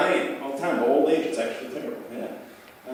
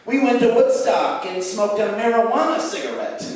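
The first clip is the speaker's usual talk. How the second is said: put-on high, prim old-timer voice, a little louder; square old hippie; 9 semitones higher, 6 dB louder than usual. Grandparents reminiscing about Woodstock, prim and quaint. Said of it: "Their version of pot is like".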